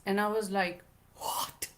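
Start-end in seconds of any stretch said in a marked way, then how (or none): 1.2-1.7 whispered disbelief, barely voiced; a stunned hushed what; shocked whisper. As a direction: gasp it under your breath in panic. Shocked at passport request.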